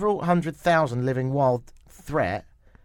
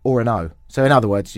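ordinary talk

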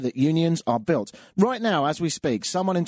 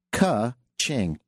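normal talking voice

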